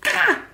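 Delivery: high sudden "ah" of frustration; mock frustrated shriek. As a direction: let out a quick high exasperated cry. Hormones going, frustrated outburst.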